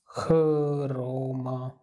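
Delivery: खरोमा stretched out very slowly, syllable by syllable; खरोमा read very slowly, syllable by syllable; drawn-out reading. Shown as a demonstration. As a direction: spell खरोमा out slowly for the class. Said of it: "इस तरह आप इसको पढ़ सकते हैं".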